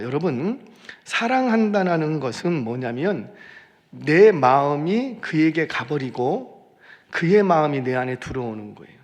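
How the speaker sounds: ordinary delivery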